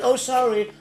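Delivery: ordinary delivery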